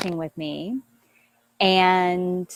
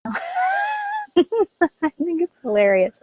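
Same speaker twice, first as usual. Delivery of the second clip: laughing; 11 semitones higher, much slower than usual. Real, not acted. Laughing at the leaf blower noise.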